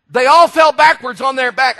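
rising preacher's emphasis. Acted. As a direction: preach it out to the back row. Repeated line, raised preaching.

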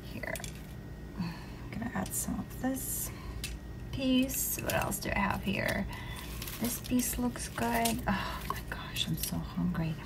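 soft unvoiced whisper. Real, not acted. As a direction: whisper it, close to the mic. Whispering while plating food.

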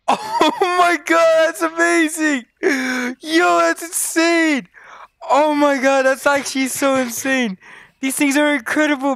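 very high, stretched-out squeal of delight; ecstatic amazement. Real, not acted. Player amazed by the incredible masks, ecstatic high exclaiming. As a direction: gush in thrilled disbelief.

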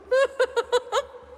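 evil cackle. Acted. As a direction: cackle in triumph. Villain gloats.

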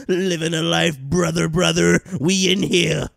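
loud wrestling-promo hype. Real, not acted. Hyping his crew like a wrestling promo. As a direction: bark it like a heel on the mic. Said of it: "wrestling promo shout-outs".

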